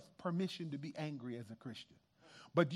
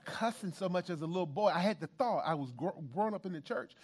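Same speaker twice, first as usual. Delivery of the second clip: usual speaking voice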